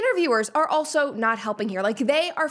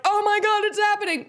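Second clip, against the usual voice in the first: mock excited gasp; 7 semitones higher than usual. Vlogger imitating fans squealing at a near kiss. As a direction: gush like a fan watching a near kiss. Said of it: "everybody was like".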